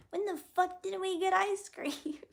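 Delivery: very high put-on movie voice; The Ringer impression. Streamer quoting The Ringer to chat, high character voice. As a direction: quote it in a squeaky-high character voice. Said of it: "that's from The Ringer".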